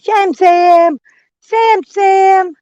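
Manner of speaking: high, loud call across the yard; calling a dog. Real, not acted. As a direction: call the dog's name high.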